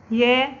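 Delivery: يا said as ya with open fatha, loud; letter ya. Shown as a demonstration. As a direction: call out يا crisply. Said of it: "الياء فوقها فتحة تنطق يا". (نطق الياء المفتوحة بصوت عالٍ)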